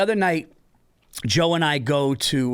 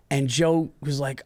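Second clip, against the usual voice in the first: normal talking voice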